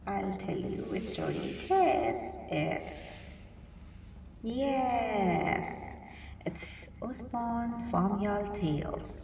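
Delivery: ordinary delivery, nothing marked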